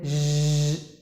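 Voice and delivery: zzz as a long buzzing zh; vibrating zh. Shown as a demonstration. Teacher showing throat vibration, zzz voiced as long zh. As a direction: hold zzz with the throat humming. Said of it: "it should vibrate here".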